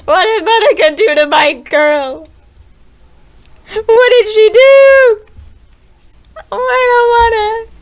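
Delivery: high, loud near-sobbing cries; distressed wail. Real, not acted. Player upset over Sayori, loud wailing. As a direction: cry out in real anguish. Horrified by the game.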